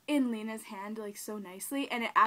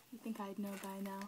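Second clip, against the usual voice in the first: usual speaking voice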